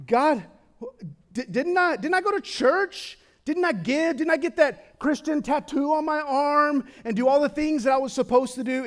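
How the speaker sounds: high, loud protesting whine of the rejected; pleading believers. Acted. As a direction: beg God to remember every good deed. Pleading people quoted before God.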